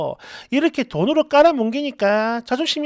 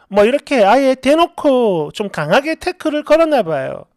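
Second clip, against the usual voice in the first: ordinary delivery, nothing marked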